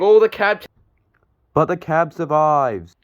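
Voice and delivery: high, loud sudden oh of surprise; surprised gasp. Real, not acted. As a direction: react as if the cab is about to fall. Cab nearly falls, survives.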